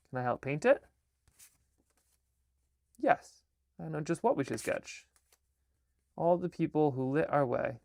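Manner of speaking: lively storybook reading with voices; reading her picture book aloud; reading to children. Acted. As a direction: read it like bedtime to kids.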